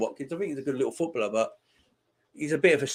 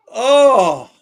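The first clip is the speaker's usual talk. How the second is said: loud, high drawn-out "oh"; agonised near-miss groan; 10 semitones higher, 12 dB louder than usual. Real, not acted. Fan sees the effort go wide, agonised groan. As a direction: cry out as it narrowly misses. The shot just misses.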